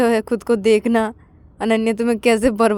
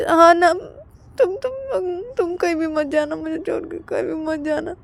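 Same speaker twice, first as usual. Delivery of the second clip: tearful plea; 9 semitones higher than usual. Acted. Ananya begging Payal to stay, tearful. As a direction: beg her not to leave.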